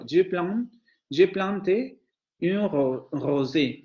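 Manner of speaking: "je plante un rosé" with oral vowels plus n; southern French. Shown as a demonstration. Teacher tries the southern pronunciation again. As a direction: say "je plante un rosé" southern style, consonant n audible. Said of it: "es que hasta cuesta trabajo".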